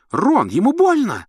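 high, loud squeal; shriek. A narrator's reading. Гермиона визжит, заступаясь за кота, пронзительно. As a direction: cry out for the cat. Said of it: "взвизгнула Гермиона".

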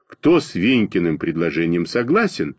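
usual speaking voice

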